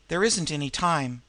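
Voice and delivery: usual speaking voice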